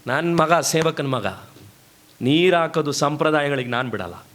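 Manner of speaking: ordinary talk, nothing marked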